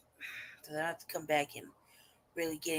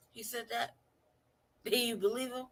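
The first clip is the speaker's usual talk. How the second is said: raised-pitch mimicry between laughs; mock incredulity; 6 semitones higher than usual. Host imitating the man's reply, mock incredulous. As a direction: repeat his comeback high, barely over the giggles.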